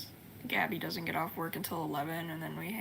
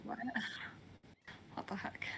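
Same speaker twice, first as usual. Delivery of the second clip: startled, hushed disbelief; shocked whisper; 3 semitones higher, 9 dB quieter than usual. Retold or imitated. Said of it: "I was like".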